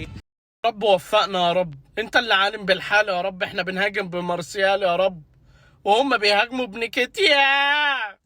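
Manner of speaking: mock prayer. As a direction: beg God for the team in a mock solemn voice. Mock prayer for his team. (دعاء ساخر بصوت أعمق بكثير)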